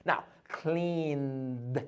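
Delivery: cleaned ending in a soft held d; controlled final d. Shown as a demonstration. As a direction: breathe the d of cleaned out gently. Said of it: "let me make it more beautiful".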